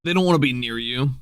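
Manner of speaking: ordinary talk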